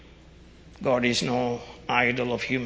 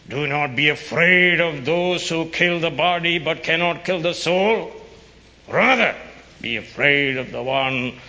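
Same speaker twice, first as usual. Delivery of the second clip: higher, louder fiery preaching; preaching at full force; 4 semitones higher, 6 dB louder than usual. Acted. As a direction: thunder the verse out.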